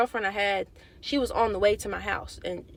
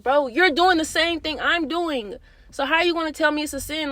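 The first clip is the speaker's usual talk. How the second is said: exasperated, louder, higher; mad retort; 6 semitones higher, 6 dB louder than usual. Retold or imitated. In her head, mad at the friend who called it a sin: exasperated. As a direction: push back at her, annoyed. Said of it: "I was just so mad".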